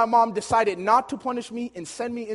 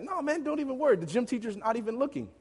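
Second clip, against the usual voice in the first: higher-pitched, egging a pal on; coaxing friend; 6 semitones higher, 3 dB quieter than usual. Retold or imitated. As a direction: talk him into it like a schoolboy. Friend coaxing him into a dare.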